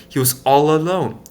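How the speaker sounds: ordinary delivery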